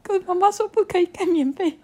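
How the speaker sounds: put-on high, teary child's whine, half laughing; whimpering child. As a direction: whimper like a cold little kid. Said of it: "然后到晚上".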